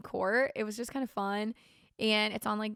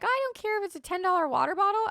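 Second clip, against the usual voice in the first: carefree big spender; 10 semitones higher, 4 dB louder, faster than usual. Retold or imitated. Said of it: "are like".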